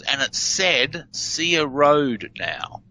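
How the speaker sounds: slow reversal read-out. Shown as a demonstration.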